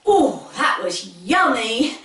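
ordinary delivery

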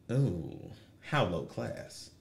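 low, soft, slow bougie drawl; mock posh disdain; snooty aside. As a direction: look down your nose quietly.

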